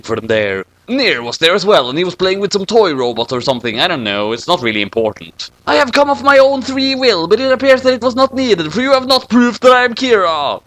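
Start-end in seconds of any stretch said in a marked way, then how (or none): 5.7-10.6 high formal villain. Acted. Dark speaks to L formally.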